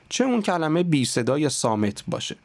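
ordinary talk

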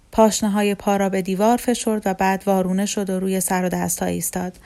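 ordinary delivery

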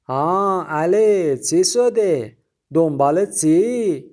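put-on slow, loud, high shopkeeper in a thick Azeri accent; nosy shopkeeper in Azeri accent; heavy Azeri accent. A narrator's reading. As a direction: drawl it in thick Azeri Persian. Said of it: "با لهجهٔ غلیظ آذری".

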